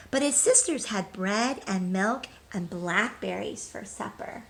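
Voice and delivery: bright, lively storybook read-aloud; reading to children. Acted. Teacher reading a picture book to children, lively.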